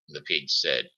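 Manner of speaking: ordinary delivery, nothing marked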